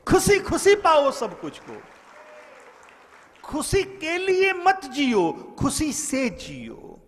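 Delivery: high, ringing sermon proclamation; fervent preacher's call; preaching at full force. Acted. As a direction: declaim it to the crowd, pitch held high. Preacher's emphatic exhortation.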